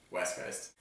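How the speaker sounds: low, creepy whisper. Shown as a demonstration. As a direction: breathe it out like a creep. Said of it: "That was a really creepy voice".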